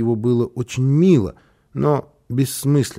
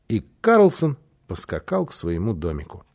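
ordinary delivery

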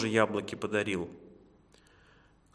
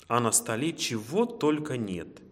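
ordinary delivery, nothing marked

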